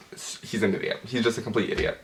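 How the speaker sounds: hushed, breathy muttered aside; quiet aside. Real, not acted. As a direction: drop your voice for a side remark. Muttered aside about the guy.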